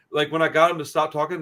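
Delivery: usual speaking voice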